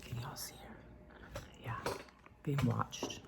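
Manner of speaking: slow, breathy whisper. Real, not acted. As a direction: whisper so the cat stays calm. Cat watching, hushed.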